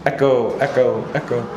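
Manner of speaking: playful echo, each repeat dropping off; mock echo. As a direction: call it out like an echo bouncing back. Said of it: "Gonna get echoey".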